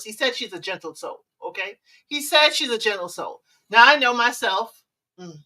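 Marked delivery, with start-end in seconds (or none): 0.0-1.8 gently. Shown as a demonstration. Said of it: "Gently, of course".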